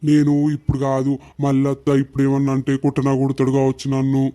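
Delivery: a hint of a scared husband; timid husband. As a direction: mutter it low, nervously.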